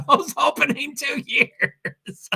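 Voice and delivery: slow words through real laughter; cracking up while reading; laughing. Real, not acted. Streamer reading funny Honda news, laughing.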